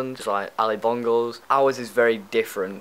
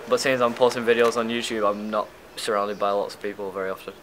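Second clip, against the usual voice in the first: ordinary talk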